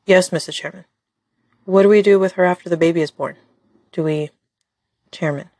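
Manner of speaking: usual speaking voice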